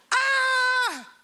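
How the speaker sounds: high piercing imitated scream, loud; girls' scream. Preacher imitating his daughters screaming at a spider, high shriek. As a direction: shriek like someone's being murdered. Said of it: "mis niñas gritaron como que alguien fue asesinado".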